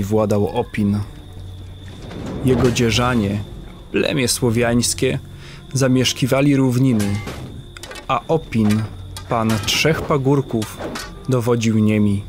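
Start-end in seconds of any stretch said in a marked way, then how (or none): none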